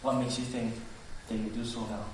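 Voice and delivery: low, quiet cynicism; cynical. Acted. Sarah inwardly doubting God's favor, cynical. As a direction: mutter it low with quiet disbelief. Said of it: "laughing cynically".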